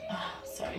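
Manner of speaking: hushed whispered aside; whispering. Real, not acted. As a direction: whisper an apology to the camera. Aside while kids walk in.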